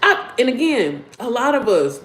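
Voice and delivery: usual speaking voice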